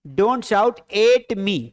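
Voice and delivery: very slow, word by word, for the class; slow model sentence. Shown as a demonstration. Teacher dictating an English sentence to students, very slowly. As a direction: spell each English word out slowly for learners. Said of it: "क्या बोलोगे?".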